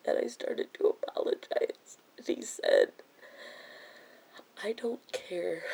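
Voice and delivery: soft, halting, voice nearly gone to a whisper; real hurt, sighing between broken phrases; close to tears. Real, not acted. Telling viewers how the best friend refused an apology, close to tears.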